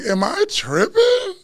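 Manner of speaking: high, slow, loud disbelief; high, drawn-out "am I tripping"; incredulous. Retold or imitated. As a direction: stretch it out like you can't believe it. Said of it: "And I'm like".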